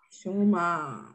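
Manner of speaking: ordinary talk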